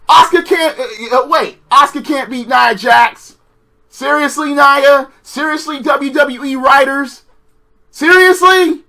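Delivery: high, disbelieving repeated questions; stunned disbelief; incredulous. Real, not acted. Vlogger asking WWE writers in disbelief.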